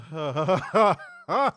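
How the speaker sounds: loud burst of laughter; big laugh. Real, not acted. Host cracking up at co-host's protest, big laugh. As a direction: crack up loudly.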